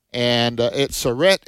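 normal talking voice

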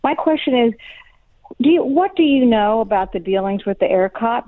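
ordinary delivery

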